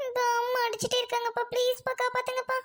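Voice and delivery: put-on high-pitched pleading child; whining plea. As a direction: beg Dad to rescue you. Child begs father for rescue. (கெஞ்சும் குழந்தைக் குரல்)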